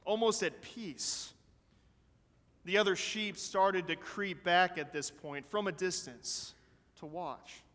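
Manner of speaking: plain reading voice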